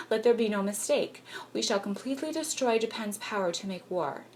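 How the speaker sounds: ordinary delivery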